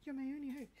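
high, loud pleading movie quote; princess plea impression. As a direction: beg for rescue like a film princess. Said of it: "That's what I was trying to do. Could you tell?".

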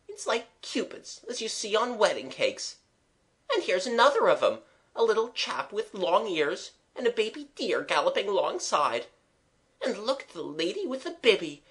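high-pitched, spellbound running commentary; rapt voice. A narrator's reading. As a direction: marvel at each sight, enraptured. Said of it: "said Eliza in a rapt voice".

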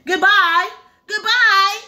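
high, loud call out; calling goodbye. Acted. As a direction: sing out a farewell up high. Fairy calls farewell flying off.

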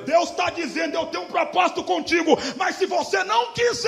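high, shouted prophetic warning; full-force preaching. Real, not acted. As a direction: raise the voice sharply and proclaim it. (pregação inflamada, gritada e aguda)

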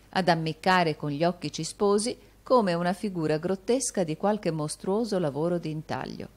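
usual speaking voice

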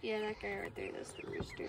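soft whisper. Real, not acted. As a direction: hush it so the birds stay calm. Whispering near rooster.

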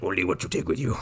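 low, soft put-on sage voice; Yoda. Imitating Yoda answering what's in there, low hushed voice. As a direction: drop it low and quiet, cryptic. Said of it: "Yoda's like".

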